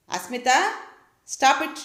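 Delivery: sharp loud stop. Acted. Husband snapping at wife to stop, loud and high. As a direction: shut her down firmly.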